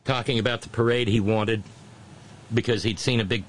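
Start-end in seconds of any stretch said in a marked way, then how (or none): none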